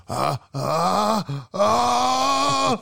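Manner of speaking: rising high-pitched scream; high hobbit scream. Acted. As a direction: shriek up into a loud cry. Frodo scream performance.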